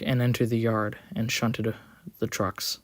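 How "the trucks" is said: usual speaking voice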